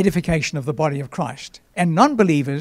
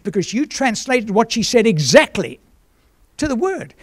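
higher-pitched, mildly amazed retelling; retold disbelief; 5 semitones higher than usual. Visitor amazed at an exact translation, imitated disbelief. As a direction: imitate someone marvelling, pitch lifted. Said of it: "shook his head in disbelief".

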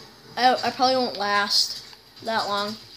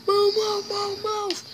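urgent; 8 semitones higher, 3 dB quieter, slower than usual. Real, not acted. Urging character to run.

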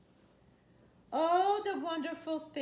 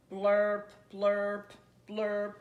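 loud blaring blurp; horn blurp; 3 semitones lower, 7 dB louder, much slower than usual. Shown as a demonstration. Reading to a child, blaring like a horn. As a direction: blurt like a horn. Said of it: "He can go like a horn".

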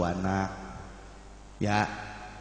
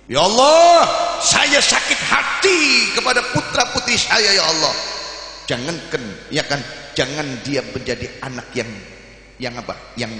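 bitter parent's prayer; 2 semitones higher, 7 dB louder than usual. Pretend. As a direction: cry out a curse on the children. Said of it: "angkat tangan ngomong apa". (doa orang tua sakit hati, berteriak)

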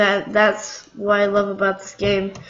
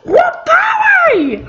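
high laughing whoop, loud; gleeful whoop; 4 dB louder than usual. Real, not acted. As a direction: let out a laughing cry of glee. Celebrating a stunt in game.

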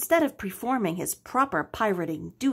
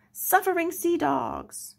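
high, put-on old salt cry; pirate exclamation; 9 semitones higher than usual. Storm shakes the ship, high startled pirate cry.

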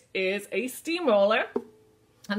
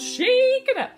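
high, loud action shout; excited call; 9 dB louder than usual. Acted.

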